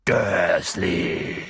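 low, drawn-out giant's boom; deep thunderous growl. A narrator's reading. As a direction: boom the name in warning. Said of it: "dröhnte er".

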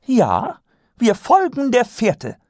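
high, grand lady declaring; announcing. Acted. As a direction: proclaim it with gusto. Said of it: "verkündete Lady Käsedick".

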